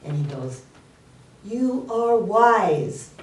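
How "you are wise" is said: very slow, raised-pitch call; acted dad calling to the echo; father calling out. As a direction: stretch each word out into the forest. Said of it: "And his dad said".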